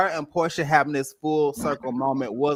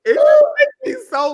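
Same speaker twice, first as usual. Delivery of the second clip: high, loud wailing fake sob; hammed-up crying outburst; over-the-top fake weeping; mock crying; 9 dB louder than usual. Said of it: "it's just so emotional".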